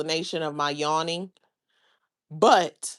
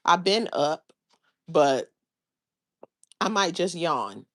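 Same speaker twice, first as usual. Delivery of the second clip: ordinary talk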